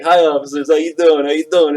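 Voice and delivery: loud, high, sugary officer greeting; overly nice greeting. Retold or imitated. Drunk guy greets passing sheriffs, loud fake-nice voice. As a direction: innocent loud hello to the sheriffs. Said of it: "I'd be like, just nice".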